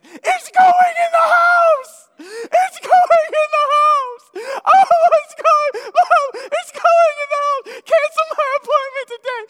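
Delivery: hysterical high shrieking, acted full out; very high, very loud panicked wail; hair-curdling scream. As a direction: scream in terror, imitating a hysterical girl. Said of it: "hair-curdling scream you've ever heard".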